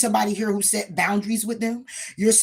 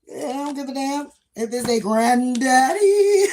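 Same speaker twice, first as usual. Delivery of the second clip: loud slow scoff; 5 semitones higher, 7 dB louder, slower than usual. Real, not acted. Laughing, dismissing a rich old partner.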